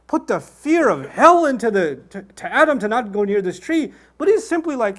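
ordinary delivery

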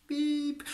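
a sudden high beep noise to bleep out the story; mock censor beep. Storyteller skipping the hotel part with a high beep noise.

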